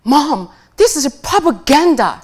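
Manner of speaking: daughter's protest. Retold or imitated. Daughter accusing her mother over the films, loud indignant voice. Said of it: "And she says".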